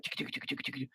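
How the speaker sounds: soft whispered chick chick clicking; chicken call. Pretend. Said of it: "I'm gonna be like".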